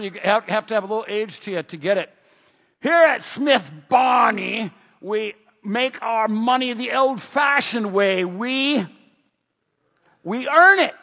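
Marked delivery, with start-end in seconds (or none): 2.8-10.9 slow, high, loud ad slogan; old commercial voice. Acted. Pastor quoting an old commercial for the congregation, booming announcer. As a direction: stretch it out like a grand TV pitchman. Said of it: "There was an old commercial".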